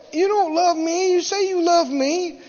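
high, whiny accusation; mock crying woman. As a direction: imitate a tearful, reproachful lover. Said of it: "she's, she's crying".

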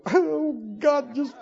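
hammed-up mock despair with a laugh; fake-plead for mercy, laughing; despairing believer. Preacher mimicking believers seeing Christ's perfection. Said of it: "we'd go".

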